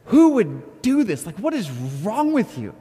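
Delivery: high, loud, exasperated retold scolding; mad, incredulous. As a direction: scold the dog in drawn-out disbelief. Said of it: "I was so mad".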